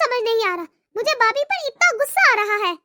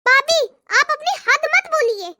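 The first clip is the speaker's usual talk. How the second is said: ordinary talk, nothing marked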